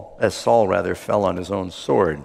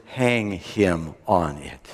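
slow, low royal death sentence; grave command; 4 semitones lower, much slower than usual. Acted. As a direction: hand down the verdict slowly and with finality. King pronounces the death sentence.

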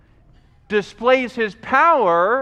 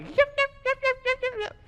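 very high, rapid little yelps; squeaky hyena yips; 5 dB quieter, faster than usual. Retold or imitated. Preacher imitates hyenas surrounding you, squeaky yipping. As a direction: yip like a pack of squeaky hyenas. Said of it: "they're kind of scary and squeaky".

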